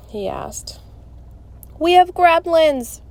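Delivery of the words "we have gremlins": alarmed news, slow and raised; blurted announcement. Acted. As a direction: blurt it loud and high, words drawn out. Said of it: "Melody blurted".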